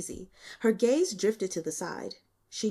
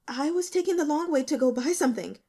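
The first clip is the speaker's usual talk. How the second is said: high, louder and fast; panicked excuse; 8 semitones higher, 3 dB louder, faster than usual. Acted.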